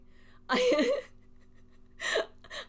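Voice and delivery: high, loud burst of laughter; wicked laugh. Real, not acted. Host amused at pushing people's boundaries, high gleeful laugh. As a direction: burst out laughing at your own mischief.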